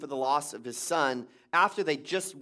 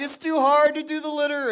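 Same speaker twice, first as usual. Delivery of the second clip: put-on whiny kid voice; 11 semitones higher, 5 dB louder than usual. Son sobbing to dad about the litter, imitated whine. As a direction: whine it out like an upset little boy.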